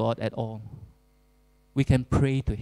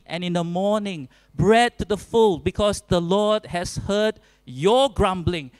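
high, loud, forceful scripture read; preaching at full force, raised voice; preaching Moses' words; 5 semitones higher, 4 dB louder than usual. Acted. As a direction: proclaim it like Moses pleading with the crowd.

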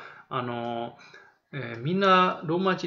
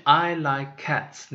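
slow model answer; much slower than usual. Shown as a demonstration. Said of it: "で、答え方はね、".

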